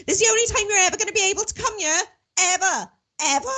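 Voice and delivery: high, quick mimic of a mother insisting; mum's voice. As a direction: rattle it off like an emphatic mum. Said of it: "I gotta do my mum's voice".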